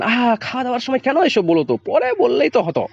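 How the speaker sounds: high, plaintive plea; a mother pleading in a high, plaintive voice. Acted. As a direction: beg him gently to stop, pitched well up. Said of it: "কাতরভাবে শুধু বলেন".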